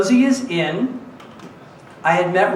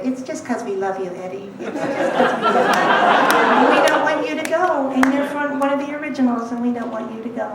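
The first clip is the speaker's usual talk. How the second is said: high, cooing imitation of a colleague; hammed-up sweet pleading; 6 semitones higher than usual. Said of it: "looked at me very sweetly and said".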